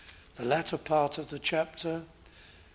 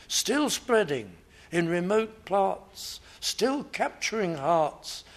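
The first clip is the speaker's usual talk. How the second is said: raised, ringing sermon voice building up; rising preaching; 4 semitones higher than usual. Acted. Pastor proclaiming the church's spread with rising fervour. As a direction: preach it out with growing force.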